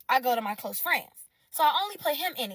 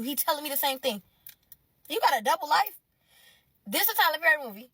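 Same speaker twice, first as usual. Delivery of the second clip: ordinary delivery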